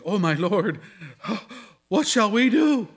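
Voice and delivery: a touch of panic, pitch climbing on what shall we do; terrified servant. Acted. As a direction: plead with mild mock fear. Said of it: "absolutely terrified".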